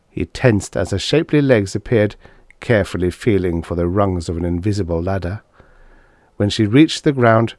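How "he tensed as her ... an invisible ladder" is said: usual speaking voice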